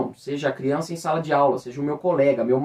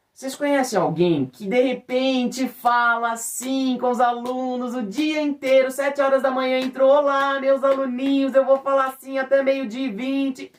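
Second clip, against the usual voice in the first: much higher, sing-song and bright; exaggerated intonation; 9 semitones higher than usual. Shown as a demonstration. Voice teacher mimicking an over-the-top classroom greeting, sing-song. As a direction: overdo the melody like a chirpy teacher. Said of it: "É uma entonação exagerada".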